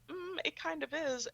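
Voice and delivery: skeptical mock reply. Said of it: "you are like".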